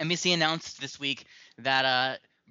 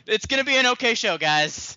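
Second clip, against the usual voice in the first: high, loud overhyped pitch; mock hype; 9 semitones higher, 7 dB louder than usual. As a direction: sell the show big and loud, tongue in cheek. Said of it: "I'm sold on that".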